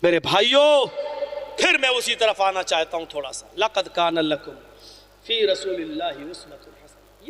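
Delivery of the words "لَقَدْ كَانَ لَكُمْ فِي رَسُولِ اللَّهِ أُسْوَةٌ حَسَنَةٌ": low, quick Arabic recitation; تلاوت، دھیمی اور تیز; Quranic recitation. Acted. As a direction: recite the verse low and fast.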